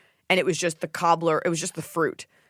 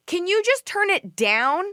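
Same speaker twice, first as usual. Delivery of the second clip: sweet high request; 12 semitones higher, slower than usual. Retold or imitated. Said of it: "And I go".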